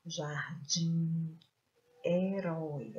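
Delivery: "jardim, herói" with strong final "dim" and "rói"; stressed last syllables. Shown as a demonstration. Teacher showing the strong syllable, "jardim, herói" stressed on their ends. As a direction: punch the endings of "jardim, herói". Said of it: "a sílaba tônica é a sílaba forte".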